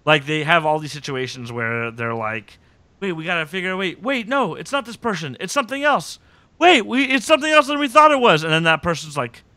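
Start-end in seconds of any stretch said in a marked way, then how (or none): 3.0-8.4 higher, louder as it builds; mock urgency, climbing in pitch; urgent mock realisation. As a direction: play agents excitedly changing their minds. Voicing agents urgently changing their theory.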